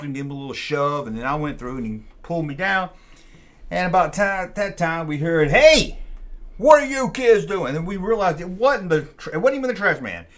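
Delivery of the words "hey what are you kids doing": high, loud, slow yell; angry shout. Retold or imitated. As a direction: bellow at the kids from across the yard. Janitor yells at the kids.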